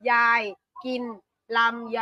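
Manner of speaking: ยาย, กิน, ลำไย said slowly, one word at a time; ยาย กิน ลำไย split by clear pauses. Shown as a demonstration. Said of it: "Do that first bit".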